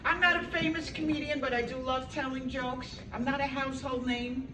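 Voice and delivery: ordinary delivery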